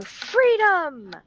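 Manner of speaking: high, drawn-out mock battle cry; playful high-pitched yell. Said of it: "but instead of yelling".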